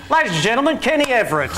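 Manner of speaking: high, loud showman intro; TV host announcing. Retold or imitated. Talk show host introducing Kenny to the audience, big showman voice. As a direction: announce him like a cheesy host. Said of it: "and went".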